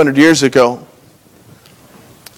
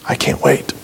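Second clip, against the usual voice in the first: whispered; 3 semitones lower, 5 dB quieter than usual. Pretend.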